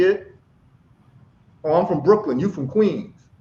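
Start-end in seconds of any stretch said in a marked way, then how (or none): none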